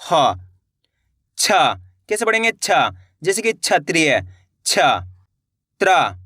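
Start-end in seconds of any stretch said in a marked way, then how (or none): 0.1-0.3 ह with air let out from the mouth; breath-released ha. Shown as a demonstration. Teacher showing pupils the letter, ह with breath released. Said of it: "मुँह से हवा छूटेगी".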